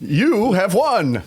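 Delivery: slow, drawn-out announcer voice; game show announcer. Pretend.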